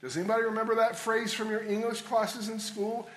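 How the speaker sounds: ordinary delivery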